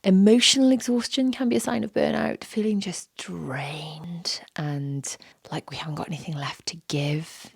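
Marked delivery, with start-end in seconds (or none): 2.4-7.5 drained whisper. Real, not acted. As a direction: let the voice sink as if drained. Voice drops describing exhaustion.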